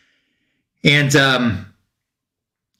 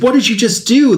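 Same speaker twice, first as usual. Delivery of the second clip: mild mock scream, pitched much higher; retold scream; 10 semitones higher than usual. Alec reacts to a man killed in front of him, retold scream. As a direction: imitate an outraged cry without really shouting. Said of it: "Alec is just screaming at him".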